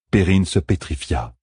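usual speaking voice

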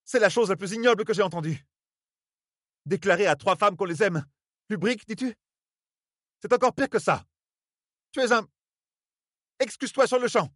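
put-on high, scandalized older woman scolding; indignant scolding. A narrator's reading. Nineveh scolds Rand for loving three women, indignant. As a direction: demand an apology, appalled.

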